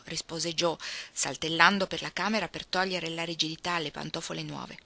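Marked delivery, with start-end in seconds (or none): none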